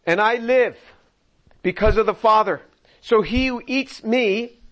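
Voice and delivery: forceful sermon reading of Jesus' words; preaching scripture. Acted. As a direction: drive each line home from the pulpit.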